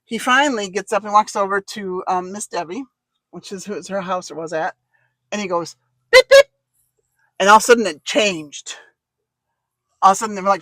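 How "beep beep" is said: very high, loud beep beep; mock beep signal. Fiance signaling the women, high playful beep. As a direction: chirp it like a horn.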